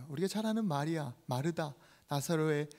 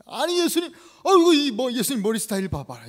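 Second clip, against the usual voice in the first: loud, high, indignant protest; angry disciple; 6 semitones higher, 11 dB louder than usual. Acted. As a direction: act out an exasperated complaint. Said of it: "화가 나서 얘기하는 거야". (화난 제자의 항의)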